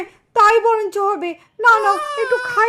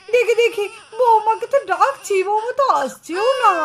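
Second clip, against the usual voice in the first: usual speaking voice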